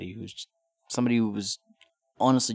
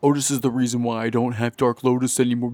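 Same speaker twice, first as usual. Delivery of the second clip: mocking whiny fan; 4 semitones higher, 7 dB louder than usual. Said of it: "they're like".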